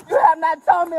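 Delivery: usual speaking voice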